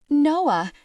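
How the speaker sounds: high, loud cry of relief; relieved cry. Acted. Tegan cries out to Noah in relief, high and loud. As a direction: call out to your rescuer. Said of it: "cried out in relief".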